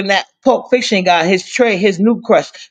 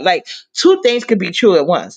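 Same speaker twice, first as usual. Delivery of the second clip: ordinary delivery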